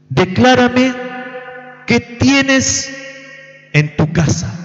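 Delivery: prophet's command. Retold or imitated. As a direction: call it out like the prophet demanding an answer. Preacher voices prophet's command to widow.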